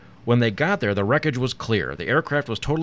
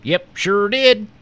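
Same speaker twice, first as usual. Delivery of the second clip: high, slow, loud country farmer; unconcerned farmer; 10 semitones higher, 7 dB louder, much slower than usual. A narrator's reading. Farmer answering Secret Service agent, unconcerned. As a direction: draw it out as an unbothered old-time farmer. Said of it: "the man muttered unconcernedly".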